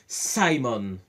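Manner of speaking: Simon said saimon with English vowels; English-style Simon. Shown as a demonstration. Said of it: "je le dis avec l'accent".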